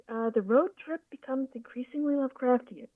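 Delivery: normal talking voice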